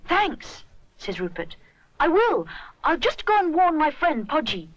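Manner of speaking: put-on high, boyish little bear voice; young bear voice. A narrator's reading. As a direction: lift into a small boy's eager voice. Rupert answers, high.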